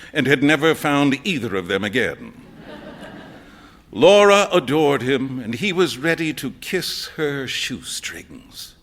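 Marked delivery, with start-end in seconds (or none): none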